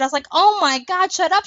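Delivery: ordinary talk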